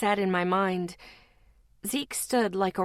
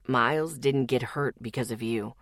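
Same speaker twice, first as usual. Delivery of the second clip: Zeke, reassuring; 9 semitones lower than usual. Acted.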